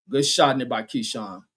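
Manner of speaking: normal talking voice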